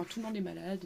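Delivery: ordinary talk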